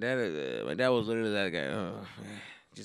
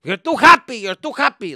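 loud, high barked accusation; mocking dad's complaint; 12 semitones higher, 17 dB louder than usual. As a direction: snap at the kid for being happy. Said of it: "dude, fucking".

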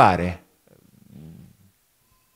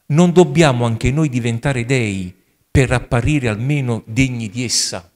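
grave, declaiming story reading with rising intensity; dramatic reading; 4 dB louder than usual. Acted. Priest reading the madman parable to the congregation, declaimed. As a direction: read it like a prophet's lament.

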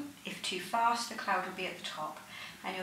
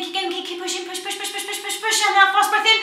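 loud, much higher pushing shout; lung function test; 11 semitones higher, 15 dB louder than usual. Real, not acted. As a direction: squeeze out every last drop. Coaching forced blow to the end.

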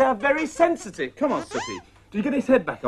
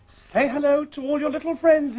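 ordinary talk

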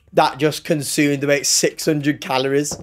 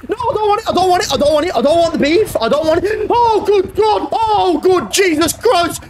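high, frantic pleading; begging the game to stop, terrified; panicked squeals of no and sorry; real panic; 12 semitones higher than usual. Real, not acted. Streamer panicking in a horror game.

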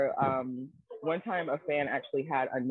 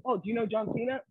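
mock clueless fan; 8 semitones higher than usual. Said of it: "It's like".

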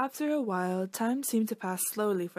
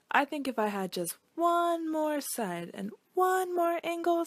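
higher, slow wishful voice; hopeful triangle; 7 semitones higher than usual. Acted. Triangle asks the shapeshifter for one more side, higher and hopeful. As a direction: ask slowly, dreaming of more.

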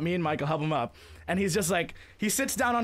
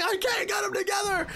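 high-pitched mock panicked shout; imitated yelling; 12 semitones higher than usual. Skier losing control of his skis, imitated panicked yelling. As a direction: yell helplessly like the stranded skier. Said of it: "He's just yelling".

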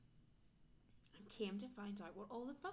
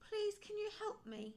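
pleading; 12 semitones higher, 4 dB louder than usual. A narrator's reading. Owl pleads with friends.

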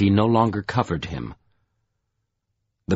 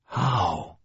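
strained, softer, tight in the throat; constricted voice; 5 dB quieter than usual. A narrator's reading. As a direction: force the word through a tight throat. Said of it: "In a constricted voice".

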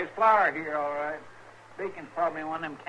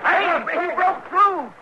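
much higher and louder, slowed with shock; alarmed shout; 11 semitones higher, 9 dB louder, slower than usual. Acted. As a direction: cry out in sudden alarm. Sidekick sees man fall through ice.

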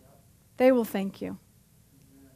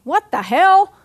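usual speaking voice

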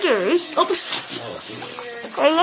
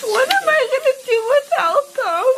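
real tearful outpouring, rising higher; crying; 3 semitones higher than usual. Real, not acted. Daughter dreading college without her parents, crying. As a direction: sob out the love, voice climbing. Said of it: "Don't cry".

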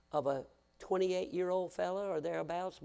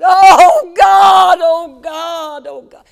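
loud, high anguished cry of a father; wailing; 12 semitones higher, 23 dB louder, much slower than usual. Retold or imitated. Preacher retelling a grieving father's wail, loud and high. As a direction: howl it out in grief. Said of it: "just wailing".

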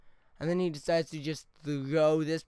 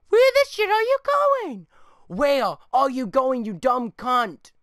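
furious high shout at the screen; angry yelling; 8 semitones higher, 11 dB louder, slower than usual. Real, not acted. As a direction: scream at the dumb player, drawn out slowly. Yelling at his own player on replay.

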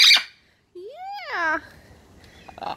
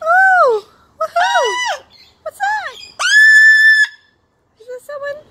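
high, loud excited calling; 8 semitones higher, 7 dB louder than usual. Acted. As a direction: call out like something's there. Playfully excited search game.

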